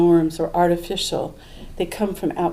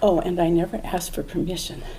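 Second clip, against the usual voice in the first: usual speaking voice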